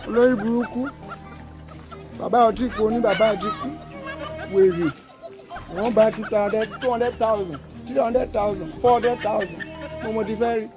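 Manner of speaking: loud scolding in Yoruba; angry Yoruba rant. Acted.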